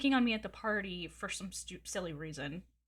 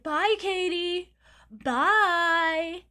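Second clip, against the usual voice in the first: high, loud, drawn-out teasing goodbye; mocking singsong bye; 8 semitones higher, 9 dB louder, much slower than usual. Guy leaving party calls out to Katie, a loud, high mocking goodbye. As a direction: stretch the bye out high and loud to taunt her. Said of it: "trying to get my attention and yell".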